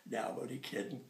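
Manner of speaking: ordinary delivery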